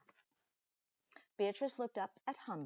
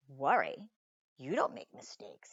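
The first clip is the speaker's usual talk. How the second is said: lightly voiced dad, lower and a touch louder; father's voice; 5 semitones lower, 6 dB louder than usual. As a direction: lower your voice like a kind father. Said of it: "he said with a smile".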